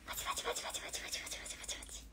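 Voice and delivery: rapid whispered pachi-pachi applause; whispered clapping sounds. Pretend. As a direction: breathe out quick mock applause. Announcing Q&A, mimics applause. (小声で早口の拍手の擬音)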